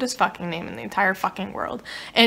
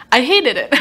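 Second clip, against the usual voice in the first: louder blunt declaration; emphatic; 3 semitones higher, 7 dB louder than usual. Real, not acted. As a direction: punch out the verdict. Blunt punchline after sad.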